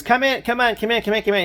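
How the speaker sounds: high, urgent calling out to come in; calling fielders in. Retold or imitated. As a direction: wave them back toward the infield with your voice. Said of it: "And he goes, he says".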